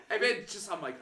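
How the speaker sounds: ordinary delivery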